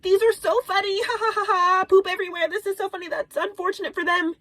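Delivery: high, fake-laughing glee; hammed-up giggly viewer scrolling videos; mock amusement. Mocking herself laughing at videos.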